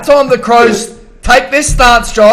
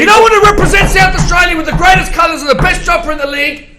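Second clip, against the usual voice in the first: fired-up rant; 8 semitones higher than usual. Real, not acted. Crows fan shouting at departing player, angry. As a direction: tell him to get out, shouting.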